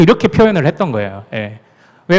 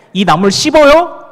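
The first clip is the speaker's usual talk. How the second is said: higher, louder Gyeongsang dialect; Gyeongsang question; 8 semitones higher, 4 dB louder than usual. Retold or imitated. Pastor imagining a local asking him about greens, Gyeongsang dialect. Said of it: "경상도적 배경이 아예 없는데".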